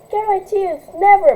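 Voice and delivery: shouted. Acted. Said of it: "shouted bravely".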